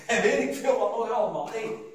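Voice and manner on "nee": normal talking voice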